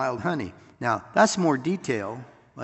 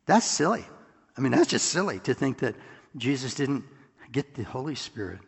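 usual speaking voice

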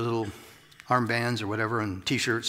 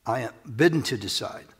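usual speaking voice

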